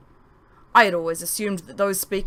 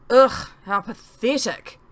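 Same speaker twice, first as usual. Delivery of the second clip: disgusted scoff; 4 semitones higher, 6 dB louder, slower than usual. Acted. Self-scorn in first-person story.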